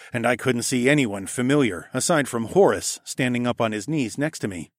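usual speaking voice

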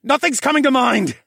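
high, loud cry of frustration; frantic yell. A narrator's reading. Failing to focus mid-chase, frantic high shout. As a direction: shout that you are getting nowhere. Panicked reply while fleeing monsters.

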